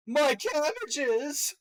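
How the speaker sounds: high, loud, drawn-out comic cry; anguished mock wail. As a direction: wail it slowly in mock despair. Quotes a catchphrase as character's in-game state.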